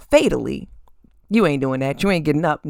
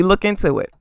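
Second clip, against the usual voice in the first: ordinary talk, nothing marked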